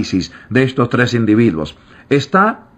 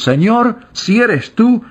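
higher, eager plea full of daring; bold eager call; 9 semitones higher, slower than usual. Acted. Preacher voicing young Pedro calling boldly to Jesus, eager and high. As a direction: call out boldly like a fearless youth. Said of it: "audaz como es la juventud".

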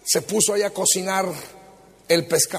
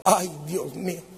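ordinary talk, nothing marked

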